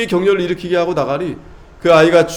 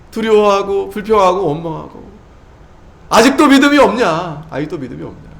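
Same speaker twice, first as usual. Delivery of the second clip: rebuke; 5 semitones higher than usual. Acted. God rebuking the congregation.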